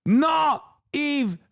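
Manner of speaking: shrieking Eve Barlow. Acted. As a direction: scream the correction, stretching each word. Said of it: "Eve Fatlow".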